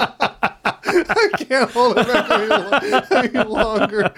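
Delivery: giggling through the words; can barely get it out for laughing. Real, not acted. Breaking up laughing.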